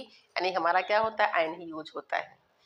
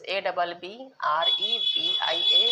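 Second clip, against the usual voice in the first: slow spelling out; 4 dB louder, much slower than usual. Shown as a demonstration. Teacher slowly spelling abbreviation aloud for the students. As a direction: spell the letters one by one, very slowly. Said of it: "यानी abbreviations".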